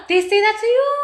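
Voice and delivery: high, loud, incredulous question; shocked disbelief. Real, not acted. Streamer hears viewer was insulted, loud shocked question.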